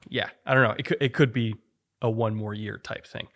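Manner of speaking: usual speaking voice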